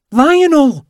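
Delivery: high piercing shriek of a woman; scream. Acted. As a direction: shriek the cat's name in panic. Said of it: "she screams".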